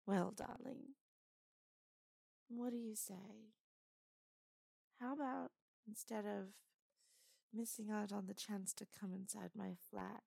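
put-on flirtatious lightly done British accent. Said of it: "so I kinda wanted to try and do British".

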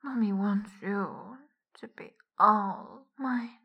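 ordinary talk

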